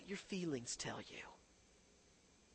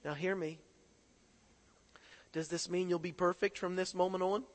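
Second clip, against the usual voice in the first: ordinary delivery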